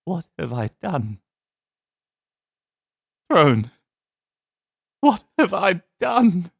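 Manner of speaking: sad Fulgrim. Shown as a demonstration. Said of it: "do the Fulgrim voice, but make it sad".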